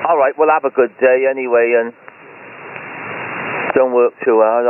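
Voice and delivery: ordinary talk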